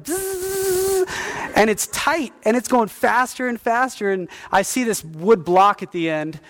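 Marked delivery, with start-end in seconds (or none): 0.0-1.1 imitated buzz. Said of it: "and it's like".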